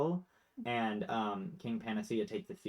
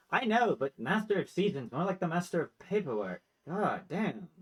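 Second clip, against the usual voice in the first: higher-pitched acted grumbling; mildly exasperated gripe; 6 semitones higher, 3 dB louder than usual. Brother grumbling about the Master of Paperwork, exasperated. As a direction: gripe in mock outrage, starting high and loud.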